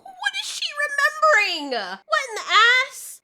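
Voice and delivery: high, loud disbelieving cry; exasperated outburst. Real, not acted. Reacting to the episode, a loud high disbelieving cry. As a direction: shout at the screen in disbelief.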